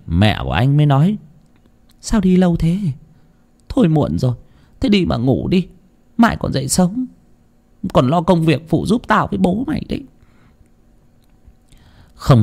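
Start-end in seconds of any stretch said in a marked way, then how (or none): 2.0-10.0 high-pitched motherly voice; higher voice of a concerned mother. A narrator's reading. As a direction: chide a late son and send him to bed, pitched up. Mother speaks to returning son.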